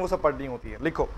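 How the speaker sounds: normal talking voice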